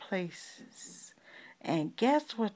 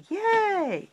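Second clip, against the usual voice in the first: cheer; 12 semitones higher, 4 dB louder than usual. Acted. Cheering in the story.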